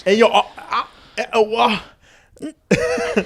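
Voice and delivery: high-pitched mock British stammer; badly faked British, fumbling for words; bad British accent. Shown as a demonstration. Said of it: "the British accent sucks".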